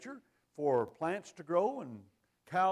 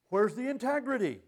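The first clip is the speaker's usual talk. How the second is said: ordinary talk, nothing marked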